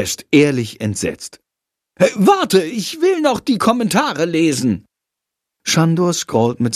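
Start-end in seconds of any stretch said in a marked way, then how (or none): 2.0-4.8 eager. Acted. Shandor eager for the comments.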